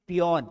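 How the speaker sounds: पियोन with an open o, "pee-YON"; पियोन, "aw" vowel before the n; taught reading of peon. Shown as a demonstration. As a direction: model पियोन as a single falling word. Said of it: "क्या पढ़ते हैं".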